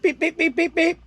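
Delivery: high, piping beep imitation; imitated beeping. Mocking the gadget, high beeping imitation. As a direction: mimic the beep.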